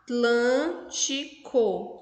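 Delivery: "Atlântico" very slowly, a long "A" standing alone; syllable by syllable. Shown as a demonstration. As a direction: stretch "Atlântico" into four separate syllables. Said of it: "o A fica sozinho aqui". ("Atlântico" bem devagar, com o "A" sozinho)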